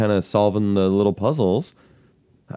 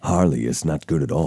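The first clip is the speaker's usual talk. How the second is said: Batman voice; 8 semitones lower than usual. Shown as a demonstration. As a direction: growl the question like Batman. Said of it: "Batman voice continued".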